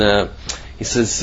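ordinary talk, nothing marked

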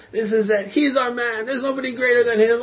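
high-pitched acclaim; retold cheering; adoring crowd. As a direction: imitate excited fans hailing their hero.

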